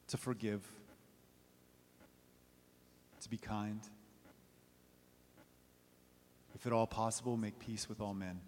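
soft, intimate reflection; quiet sermon close. Acted. Preacher closes quietly to the congregation, soft and intimate.

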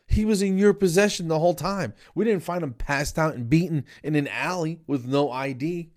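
high-pitched, incredulous protest; indignant. Retold or imitated. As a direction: argue back at the vet in mock outrage. Acted indignant argument with the vet.

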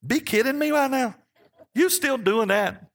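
high, incredulous mock child voice; God voiced like the grandson. Preacher voicing God in his grandson's cheeky phrase, high and teasing. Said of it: "God on his throne looks at my life and says".

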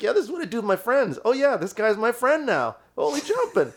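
high, fast thrilled inner voice; giddy disbelief. Retold or imitated. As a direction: squeal inside like a fan meeting his idol. Inner thrill playing with idol.

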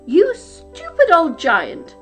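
high, loud, slow exasperated jibe; scolding wife. A narrator's reading. Wife scolding her giant husband, loud exasperation. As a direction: draw out the insult at full voice.

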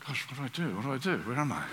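softly imitating a puzzled listener, hammed-up; mock bewildered. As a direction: play the lost congregation, half-joking. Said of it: "I think we'd be saying".

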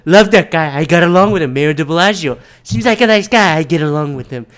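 loud, ranting Trump impression, lightly voiced; a loose, hammed-up Trump rant. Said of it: "he comes back and like".

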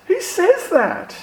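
very high, drawn-out disbelief; high-pitched, slow astonished question; incredulous. Acted. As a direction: squeak it out slowly in sheer bafflement. Mock disbelief at the claim.

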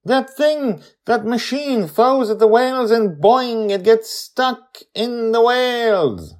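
put-on high, loud kid voice explaining; eager child. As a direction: describe it like a keen little girl. Joy explains to grandparents.

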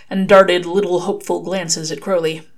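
ordinary delivery

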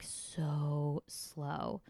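very slow, lingering on each word; drawn-out slowness. Retold or imitated. Complaining about southern pace to co-host, drawn out slowly.

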